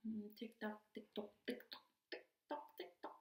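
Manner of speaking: barely voiced, whispered ticking; hushed clock imitation. Waiting in the holding cell to get out, hushed ticking. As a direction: count off the wait like a quiet clock.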